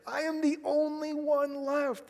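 high, drawn-out lament; anguished complaint. Acted. Elijah complaining to God that he alone remains, high and drawn out. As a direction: stretch it out high, all alone.

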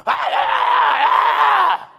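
slow, drawn-out mock demonic wails; hammed-up possessed moaning; wild demon cries. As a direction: howl like a crazed demon. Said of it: "Crazy and wild".

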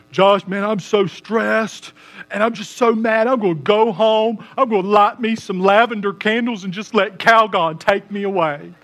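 lightly mocking voice of a stressed guy craving a bubble bath; pampered guy. Preacher mocks a man unwinding with candles, in a hammed-up pampered voice. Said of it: "I have yet to meet a dude who's like".

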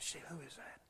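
hushed whisper to his wife. Retold or imitated. Husband whispering to his wife on the plane, hushed. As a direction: lean in and whisper so no one hears.

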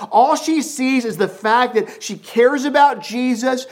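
high, loud sermon delivery; impassioned preacher voice; full-force preaching. Acted. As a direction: drive the story home from the pulpit.

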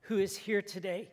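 gentle closing prayer voice. Acted. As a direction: pray it quietly and humbly to God. Pastor leads opening prayer.

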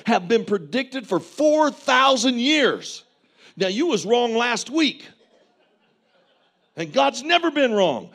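loud, high, driving sermon; full-force preaching. Acted. Preacher urging the congregation to trust God, at full force.

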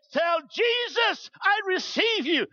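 high, drawn-out revival cry; fervent command. Retold or imitated. Preacher praying over a shaking woman, fervent command. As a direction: imitate an insistent preacher praying someone through.

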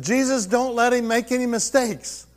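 high-pitched, hammed-up plea to heaven; urgent comic prayer. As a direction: pray it out as a mock-desperate cry. Retold urgent prayer before surgery, joking.

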